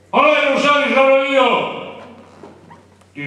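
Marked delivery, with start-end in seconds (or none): 0.1-1.8 loud, high indignant bark; arrogant shout. Retold or imitated. The officer on the bus, shouting arrogantly at the driver. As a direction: shout down the whole bus with your rank.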